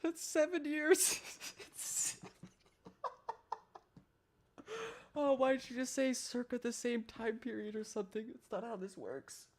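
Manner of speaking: high, squeaky voice breaking into laughs; real helpless laughter pushing the pitch way up; crying with laughter. Real, not acted. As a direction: try to talk while laughing yourself to tears. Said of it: "I'm crying".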